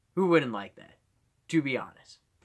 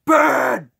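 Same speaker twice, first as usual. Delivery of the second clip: loud, high imitated yell; Bat Dad; 13 semitones higher, 13 dB louder than usual. Father calling his little son Ben, shouted Bat Dad voice. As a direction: holler the son's name in costume voice. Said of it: "he actually would be like".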